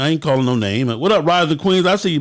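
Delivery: ordinary talk, nothing marked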